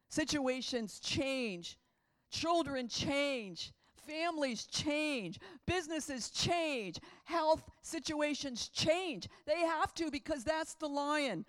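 loud, slow, each "change" hammered out; full-force preaching. Acted. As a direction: preach it like a roaring lion.

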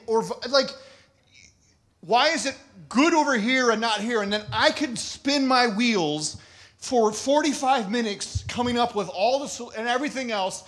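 ordinary talk, nothing marked

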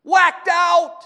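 loud, high, drawn-out exclamation; fired-up preaching. Real, not acted. Preacher to congregation on the possessed man, fired-up shout. Preacher at full force describing madman.

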